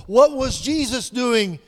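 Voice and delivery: loud, high, driving sermon build; preaching at full force. Acted. Preacher rousing the congregation about deliverance, full-force preaching. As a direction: lift the room with each question.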